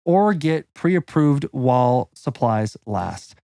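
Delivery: punchy, deliberate announcer-style read; sponsor ad read. Acted. Podcast guest reading a mortgage ad, punchy and deliberate. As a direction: sell it line by line, stressing key words.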